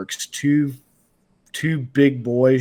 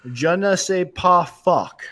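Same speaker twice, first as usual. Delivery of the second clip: put-on light French accent, higher and slower, half-joking; mock French accent; 6 semitones higher, slower than usual. Imitating Cyril Gane cursing in French, in a light mock French accent. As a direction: toss it off in a playful fake French accent. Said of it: "as Cyril Gane would say it in French".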